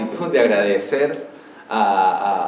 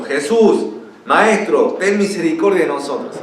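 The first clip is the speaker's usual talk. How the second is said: higher, louder cry for mercy; raised-voice plea; 6 semitones higher, 5 dB louder than usual. Acted. Lepers calling to Jesus for mercy, raised voices. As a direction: call out as if to someone far off. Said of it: "alzaron la voz diciendo".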